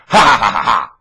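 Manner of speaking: loud ha-ha taunt; shouted mock laugh. Preacher taunting the world, a loud mocking laugh.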